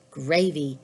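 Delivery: plain reading voice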